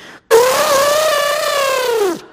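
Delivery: very high, loud elephant call; imitated elephant, sustained and piercing; elephant trumpet. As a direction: blast out a long elephant trumpet. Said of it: "[name], do an elephant".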